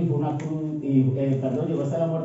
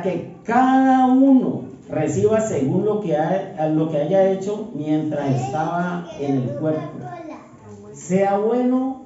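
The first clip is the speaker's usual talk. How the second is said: slow, louder solemn recitation; weighty pulpit reading, each word given room; scripture reading; 2 semitones higher, 3 dB louder, slower than usual. Acted. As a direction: proclaim the verse to the congregation. Reads Corinthians verse aloud.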